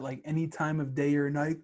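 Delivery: usual speaking voice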